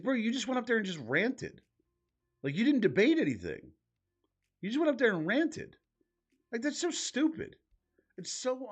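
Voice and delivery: high exasperated rant at the debater; incredulous rant. Real, not acted. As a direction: rant like you can't believe it. Ranting about the debate.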